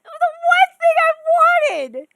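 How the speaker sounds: loud, high re-enacted exasperation; frustrated cry. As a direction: replay the moment of outrage. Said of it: "I was like".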